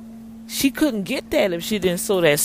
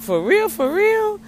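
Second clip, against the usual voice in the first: much higher, louder delighted shout; overjoyed shout; excited shouting; 13 semitones higher, 5 dB louder than usual. Retold or imitated. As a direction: yell it, can't believe it. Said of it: "I'm up there shouting".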